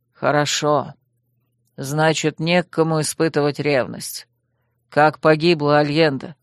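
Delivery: low, measured lord's voice; low male character asking about a death; questioning dragon lord. A narrator's reading. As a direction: drop the pitch for a grave interrogator.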